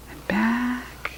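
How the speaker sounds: soft whispered and back. A narrator's reading. Reader to children, softly whispered. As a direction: breathe it out.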